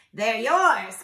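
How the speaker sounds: loud, generous call. A narrator's reading. Woodpecker offers holes to squirrel, calling out. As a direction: call it out heartily. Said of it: "called the woodpecker".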